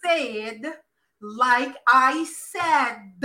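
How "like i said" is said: drawn out. Real, not acted. Host restating her demand to listeners, drawn out. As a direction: stretch each word out very slowly.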